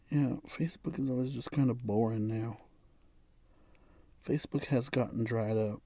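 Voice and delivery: low-volume, bored remark; quiet, uninterested mumbling; bored aside. Real, not acted. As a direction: say it softly, half to yourself. Bored aside while scrolling.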